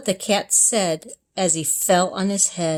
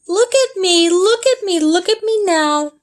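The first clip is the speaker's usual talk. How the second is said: high, loud show-off cry; 9 semitones higher, 6 dB louder than usual. A narrator's reading. The cat shouts to the children to watch, high and loud. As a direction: crow it like a cat balancing on a ball. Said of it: "cat shows off on ball".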